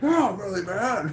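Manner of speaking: high mock-mad outburst breaking into laughter; retold angry yell. Manager confirming his anger to employee, mock yell. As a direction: imitate an angry manager, amused. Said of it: "he started yelling at me".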